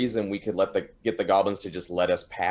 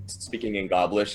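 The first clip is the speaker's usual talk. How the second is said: ordinary delivery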